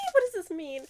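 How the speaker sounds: baffled. Real, not acted. Baffled by blurb.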